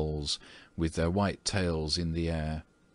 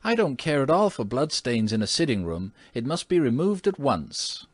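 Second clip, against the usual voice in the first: indignant lady; 7 semitones higher, 4 dB louder than usual. Acted. Mrs. Otis insists, indignant.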